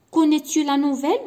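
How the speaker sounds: كونيتو لا نوفيل said high and loud with a rising question tune. Shown as a demonstration. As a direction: ask كونيتو لا نوفيل, lifting the voice at the end. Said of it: "هنا سي اون كيسيون". (نبرة سؤال صاعدة في كونيتو لا نوفيل)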